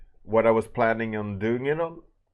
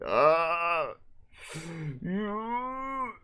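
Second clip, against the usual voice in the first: long high-pitched "ah", then higher held whine; mock dismayed wail; 10 semitones higher than usual. Lecturer retells reacting to the email, mock dismayed wail. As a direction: act out a drawn-out, squealing noise of dismay. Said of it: "And I was like".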